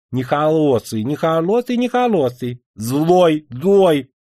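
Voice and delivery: нехолосый with х for р, rising each time, lisping; says w for r. Acted. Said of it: "разнообразно картавя".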